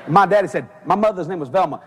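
normal talking voice

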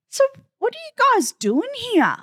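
high-pitched mock visitor question; imitating the visitor. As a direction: ask it in a put-on high voice. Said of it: "And the guy goes".

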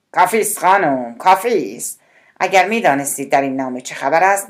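loud angry outburst at the secretary; shouting. A narrator's reading. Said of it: "با فریاد گفت". (با فریاد و خشم)

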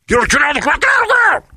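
frantic, very high, loud imitation; screamed police command. Officer ordering him down, imitated in a garbled scream. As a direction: scream it until the words blur.

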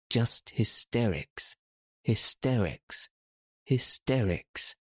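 "hysterics" hit anew each time, falling; stamped emphasis. A narrator's reading. Mary stamping at the boy, "hysterics" emphatically repeated. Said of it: "she stamped each time she said it".

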